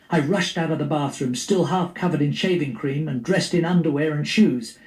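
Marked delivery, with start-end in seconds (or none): none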